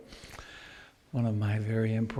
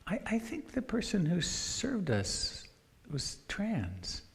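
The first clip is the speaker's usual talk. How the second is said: softer, much higher, hesitant retelling; quoted wife's remark; 7 semitones higher, 6 dB quieter, slower than usual. Retold or imitated. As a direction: quote her gently in a raised pitch. Quoting wife's remark at dinner.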